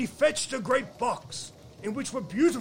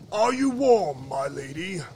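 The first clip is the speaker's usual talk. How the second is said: commanding storybook monarch; frost king; 6 dB louder than usual. Acted. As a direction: call it out like an imperious winter king. Frost king questions the freezing girl.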